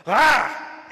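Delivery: loud, high mock monster roar; sudden burst imitating her outcry; imitated sudden snarl. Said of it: "all of a sudden it's like".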